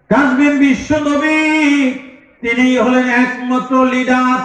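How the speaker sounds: high, ringing sermon delivery; full-force preaching. Real, not acted. Preacher warning the crowd of Judgment Day, full force. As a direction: preach it high with stretched key words.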